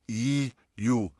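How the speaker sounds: ई, यू said thin and drawn out; thin ye sounds. Shown as a demonstration. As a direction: keep ई, यू fine and stretched. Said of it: "या का ही sound देना है". (बारीक खिंचा हुआ उच्चारण)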